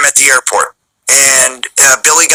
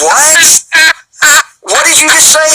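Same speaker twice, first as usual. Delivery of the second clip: incredulous what; 10 semitones higher than usual. Retold or imitated. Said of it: "and I'm just like".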